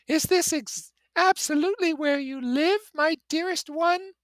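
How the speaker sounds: very high, loud, gushing socialite; Daisy's rippling voice. Acted. Daisy gushing to her cousin from the car, very high and bright. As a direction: sing it out like a delighted society girl. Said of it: "exhilarating ripple of her voice".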